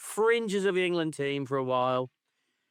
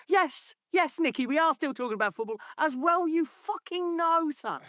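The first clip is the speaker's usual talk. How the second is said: mocking journalist. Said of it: "It's like".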